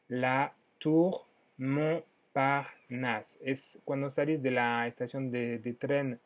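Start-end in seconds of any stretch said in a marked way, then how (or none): 0.1-3.4 "Tour Montparnasse" muy despacio, estirado; más lento. Shown as a demonstration. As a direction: desgrana el nombre muy despacio para que lo copien. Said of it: "repite más lento". (la profesora repite el nombre muy despacio)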